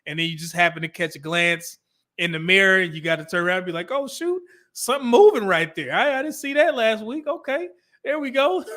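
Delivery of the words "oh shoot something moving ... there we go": higher-pitched playful amazement; mock-impressed with yourself, ending in a laugh; self-admiring surprise. Lifter spotting new muscle in the mirror, playful high surprise.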